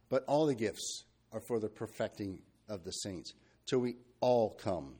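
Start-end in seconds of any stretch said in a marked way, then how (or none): 3.7-4.9 "all" set apart and stressed, then fall on "come". Real, not acted. Preacher underlining a verse for the group, stress on "all". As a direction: lean firmly on "all" and draw it out. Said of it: "emphasis on the word all".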